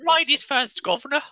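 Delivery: high-pitched mock Cockney; cheeky caricature of British speech; Antoine's British accent. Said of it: "ladies first in Antoine's accent".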